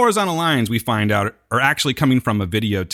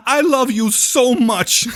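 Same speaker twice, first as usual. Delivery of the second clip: much higher, louder, slow gushing declaration; Abraham's love cry; 10 semitones higher, 4 dB louder, much slower than usual. Retold or imitated. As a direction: overblown tearful devotion, hammed up. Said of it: "He tells him".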